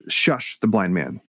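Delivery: ordinary delivery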